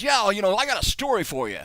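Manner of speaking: high, loud hammed-up Australian; badly faked Aussie storyteller's opener; bad Crocodile Dundee accent. [name] offers a story over the phone, bad Australian accent. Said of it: "this is my very bad Crocodile Dundee impression".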